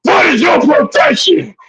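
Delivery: shouted high like a movie warrior; rally the troops with a roar; battle cry. Retold or imitated. Host rousing the viewers with a war cry.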